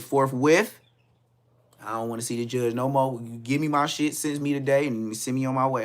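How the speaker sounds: loud, insistent acceptance; emphatic fed-up declaration; eager loud. Retold or imitated. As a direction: demand it be over now. Eager to sign now.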